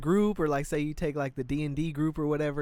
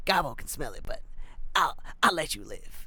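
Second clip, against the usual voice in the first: goblin voice. Retold or imitated. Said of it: "And I'm like".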